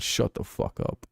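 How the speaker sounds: slow, hushed put-down; muted dismissal. Retold or imitated. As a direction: drop the volume and draw it out. Dismissing a player's angry rant.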